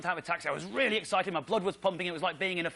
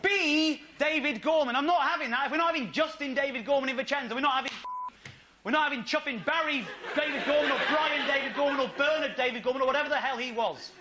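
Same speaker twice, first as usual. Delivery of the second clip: high, raised mock-outraged rant; indignant rant; 7 semitones higher than usual. At the New York nameplate, a high indignant rant. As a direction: rail against the middle-name Dave Gorman.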